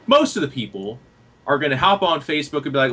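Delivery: normal talking voice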